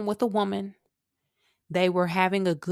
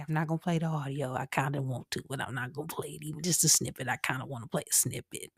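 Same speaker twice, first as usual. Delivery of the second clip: quiet aside; 6 semitones lower, 6 dB quieter, faster than usual. Real, not acted. Tempted aside to herself.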